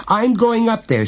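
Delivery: very high, agitated cry; trembling cry. A narrator's reading. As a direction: cry it out, frightened.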